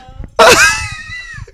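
high crying laugh; shrieking laugh. Real, not acted. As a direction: laugh until you squeal. Laughing at mom.

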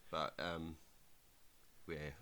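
ordinary delivery

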